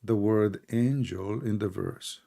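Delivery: soft, stretched-out question; slow, measured questioning; deliberately slow. Real, not acted. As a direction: draw each word out quietly. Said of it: "I'm speaking slowly".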